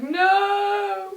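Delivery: high, drawn-out "no"; mock anguished cry. First use of a perfect lipstick, mock anguish. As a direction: wail it like a playful tragedy. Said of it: "you're like".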